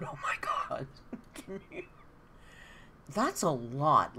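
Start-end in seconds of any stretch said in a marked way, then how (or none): 0.0-1.8 laughing whisper. Real, not acted. Laughing at own joke.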